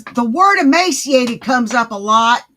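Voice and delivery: the word emaciated said loud and pointed; loud, pointed remark; loud pointed statement. Shown as a demonstration. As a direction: call out the word emaciated loudly. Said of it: "repeats the same line slower".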